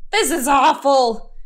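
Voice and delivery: a touch of hammed-up gloom; glum complaint. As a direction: moan about a dull Saturday. Fox alone and glum on a bench.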